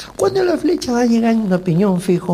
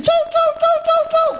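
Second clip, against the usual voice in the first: much higher, sing-song imitation of a TV host waving goodbye; Tinelli's goodbye. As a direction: chirp the goodbyes in a squeaky put-on voice. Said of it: "viene Tinelli y dice".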